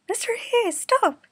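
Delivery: high, loud alarmed cry; high frantic call to stop the car. Acted. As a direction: shout a warning from the back seat. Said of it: "Yukina cried".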